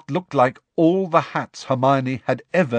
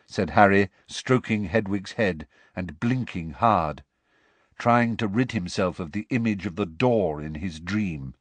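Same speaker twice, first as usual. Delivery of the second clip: ordinary delivery, nothing marked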